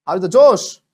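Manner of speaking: high, very loud rallying shout; commander's war cry. Acted. Teacher plays commander to students as commandos, shouting. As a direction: draw out a roaring call to troops.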